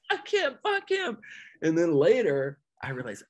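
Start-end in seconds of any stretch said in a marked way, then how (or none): 0.1-1.2 high, whiny sulky voice; mock childish grumble; petulant kid voice. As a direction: sulk like an upset little kid. Sulking after being mocked.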